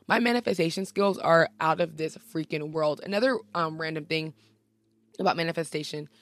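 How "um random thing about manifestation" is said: normal talking voice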